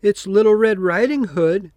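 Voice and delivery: put-on wolf faking the girl. Wolf pretends to be the granddaughter at grandmother's door, high sweet voice. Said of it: "said the wolf".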